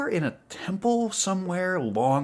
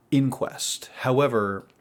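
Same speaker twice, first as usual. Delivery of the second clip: normal talking voice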